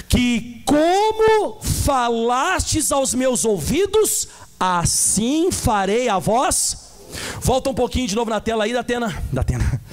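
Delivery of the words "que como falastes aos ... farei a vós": very slow, weighty pronouncement; solemn divine verdict. Acted. Pastor reading God's verdict to Israel, very slow and weighty. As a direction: lay down God's sentence word by word.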